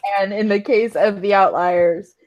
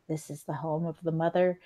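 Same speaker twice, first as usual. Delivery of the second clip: softly spoken character; 9 dB quieter, faster than usual. Acted. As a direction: say it softly, as overheard speech. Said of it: "those of you who can hear her a little better".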